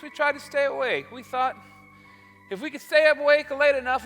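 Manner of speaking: usual speaking voice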